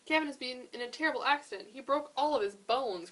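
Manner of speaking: fast, loud distressed cry; cried out. A narrator's reading. Rhino cries out the news of Kevin's accident, fast and loud. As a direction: rush out the terrible news. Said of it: "cried Rhino".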